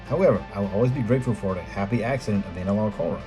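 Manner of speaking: normal talking voice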